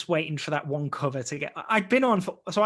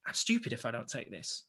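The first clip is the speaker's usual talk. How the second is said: muttered self-talk; 6 semitones lower, 8 dB quieter than usual. Retold or imitated. Talking himself into taking the job.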